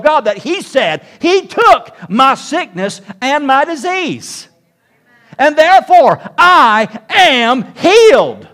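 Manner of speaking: loud, high, slow emphatic declaration; full-force preaching. Acted. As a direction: proclaim it, drawing out the key words.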